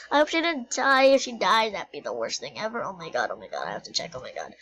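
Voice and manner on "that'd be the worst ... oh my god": low dread. Pretend. Dread over friend's death.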